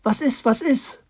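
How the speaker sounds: put-on high eager child's voice; excited. A narrator's reading. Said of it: "mit aufgeregter Stimme".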